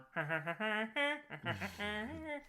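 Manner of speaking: high, stuttering horn honk; imitated truck horn. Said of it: "then you hear Grandpa's horn".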